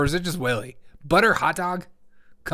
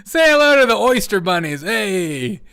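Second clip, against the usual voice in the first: loud, high hammed-up callout; mock Biden announcement; 6 semitones higher, 9 dB louder than usual. Host mimicking Biden's bunny clip, loud mock shout. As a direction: holler it like a goofy event host.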